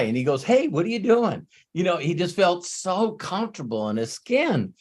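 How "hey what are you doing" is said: lightly voiced casual guy, pitched up; relaxed guy greeting. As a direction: toss off a breezy, carefree hello. Man greets him at the corner.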